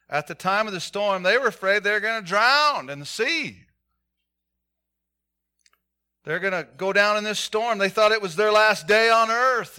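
building sermon intensity; rising preacher's fervor. Real, not acted. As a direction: preach it hard to the congregation.

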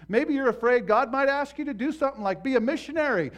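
loud, driving pulpit preaching; preaching at full force. Acted. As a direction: hammer each point home to the congregation.

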